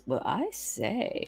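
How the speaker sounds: slow, quiet, prim "well, I say"; a hint of a shocked English gent; startled gentleman. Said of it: "he goes".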